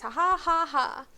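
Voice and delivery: slow, high mocking laugh; drawn-out villainous ha-ha; gloating cackle. A narrator's reading. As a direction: cackle in mean triumph. Peeves laughs at tricking Filch.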